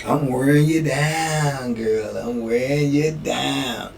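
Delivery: slow, soft, nasal-free sitcom nerd croon; drawn-out low sweet-talk; Steve Urkel impression. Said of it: "As like Steve Urkel say".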